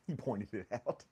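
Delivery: quiet, laughing under the words; soft laugh. Real, not acted. To the chat about Terry's comment, quiet laughing. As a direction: chuckle it out softly.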